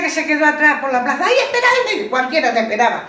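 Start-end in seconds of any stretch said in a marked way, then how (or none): 1.2-2.1 high, pleading call from behind; high plea. Retold or imitated. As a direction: cry out to wait. Friend left behind calling out.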